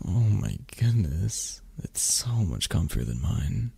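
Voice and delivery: ordinary talk, nothing marked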